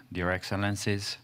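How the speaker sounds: normal talking voice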